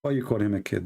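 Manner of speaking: normal talking voice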